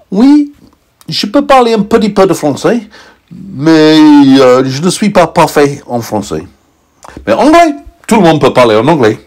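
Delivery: high squeaky French; meteorite speaking French. A narrator's reading. Meteorite answers in French, high squeak. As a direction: chirp the French line brightly. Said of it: "the meteorite said in French".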